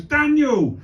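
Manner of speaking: high, loud call across a station; insistent high-pitched shout for someone. Retold or imitated. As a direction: yell the name, pitch falling away at the end. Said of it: "he's just stood there shouting".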